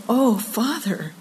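soft, slow "Oh Father"; hushed, gentle prayer; reverent address. Shown as a demonstration. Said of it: "We should be saying".

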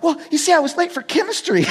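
high-pitched, mock meek student; pleading excuse. Teenage driver explaining himself to cop, high pleading. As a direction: plead your case in a high voice.

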